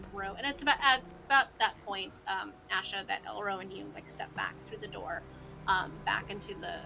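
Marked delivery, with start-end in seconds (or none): none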